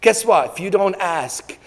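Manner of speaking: ordinary delivery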